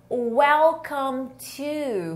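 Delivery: very slow, drawn-out "Welcome to"; slow model pronunciation. Shown as a demonstration. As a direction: stretch out each word for learners. Slow model phrase for learners. (bem devagar, palavras alongadas)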